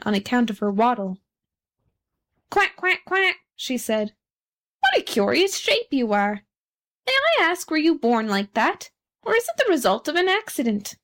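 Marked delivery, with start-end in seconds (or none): 2.5-3.4 high-pitched duck quacks; duck quacking. A narrator's reading. Narrator gives the duck's call. 4.8-11.0 higher, swooping duck character; inquisitive duck. A narrator's reading.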